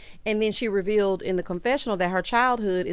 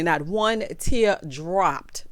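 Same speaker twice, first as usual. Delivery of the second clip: slow emphasis; 3 semitones lower, much slower than usual. Real, not acted. Mocking Contessa's dry eyes.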